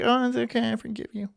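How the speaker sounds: put-on high, soft, feeble boyfriend voice; mock a pushover in a small high voice; meek forgiving guy. Cheated-on guy forgiving her, mocked as meek and high. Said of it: "the guy's gonna be like".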